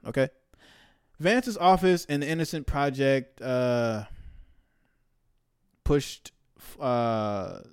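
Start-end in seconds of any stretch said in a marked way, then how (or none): none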